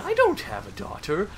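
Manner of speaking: a hint of a creepy mother's voice; voiced possessed mother. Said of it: "the woman is all like".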